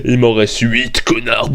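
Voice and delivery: low, smug taunt from the gunman; gloating gunman. Retold or imitated. To the Magnum owner, a low smug taunt. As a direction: sneer it out like you just won the duel. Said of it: "tu fais".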